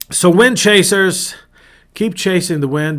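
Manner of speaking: loud, high, slow address; rallying call. Real, not acted. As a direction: call out to the listeners.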